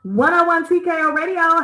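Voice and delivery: loud, high station call-out; energetic radio host shout-out; radio ident. Real, not acted. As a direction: announce the station like a DJ.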